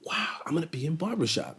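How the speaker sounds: awed unvoiced whisper; whispered excitement. Young actor to himself after casting, awed whisper. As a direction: breathe it out in quiet awe. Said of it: "And I went".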